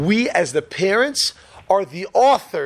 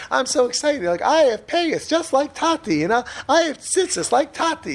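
put-on high, excited little boy voice; lightly mimicking a thrilled three-year-old; imitating little son; 8 semitones higher than usual. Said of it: "he's like".

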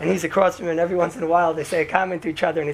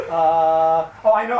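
ordinary talk

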